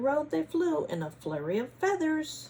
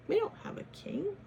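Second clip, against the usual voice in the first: ordinary delivery, nothing marked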